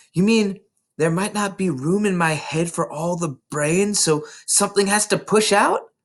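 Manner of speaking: put-on high, bright child voice tumbling with excitement; eager little girl. A narrator's reading. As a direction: play Matilda bursting to tell her teacher. Matilda's innocent question.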